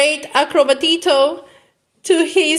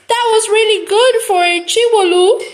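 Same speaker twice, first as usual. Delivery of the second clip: higher, quick and bright with praise; excited child; 8 semitones higher, 3 dB louder, faster than usual. Acted. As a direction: gush it fast like an eager little girl.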